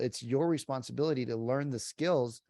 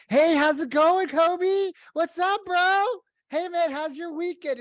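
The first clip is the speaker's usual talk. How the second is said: mock hyped greeting, much higher and louder; over-excited caller; 8 dB louder, slower than usual. Agent faking excitement with stranger prospect: squealing high greeting. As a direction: ham up a fake buddy, loud and high. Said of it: "you're, like, super excited".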